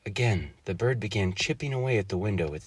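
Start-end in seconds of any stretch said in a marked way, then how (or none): none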